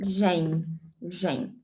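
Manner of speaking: "gen" with a soft zh as in measure; Portuguese ending. Shown as a demonstration. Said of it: "we in Portuguese have words ending".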